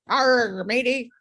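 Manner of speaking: lightly voiced pirate growl; a quick hammy pirate. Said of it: "Talk Like a Pirate Day".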